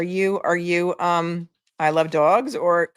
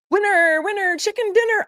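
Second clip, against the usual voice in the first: excited winner call. Real, not acted. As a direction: cheer it out like a game show host. Announcing the giveaway winner.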